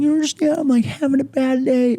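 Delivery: very high, whiny mock voice; mock whiny plea. Roger stuck in the tree pleading with his teammate, mock whine. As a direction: plead like a hurt crybaby. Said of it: "He's like".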